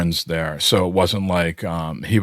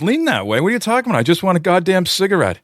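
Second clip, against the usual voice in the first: pitched way up in indignant confusion; baffled outrage; 11 semitones higher, faster than usual. Retold or imitated. Bassist baffled by the A&R guy, high and indignant. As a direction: splutter in disbelief, then snap for a cigarette. Geezer baffled, then exasperated.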